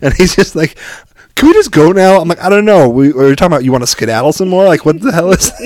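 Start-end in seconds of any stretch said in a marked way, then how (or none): 1.4-2.2 high, loud pleading complaint; imitated exasperated kid. Son begs teasing dad to leave, imitated exasperation. 2.4-5.5 raised, louder teasing retort; mock indignation. Dad keeps ribbing son over skedaddle, mock indignant.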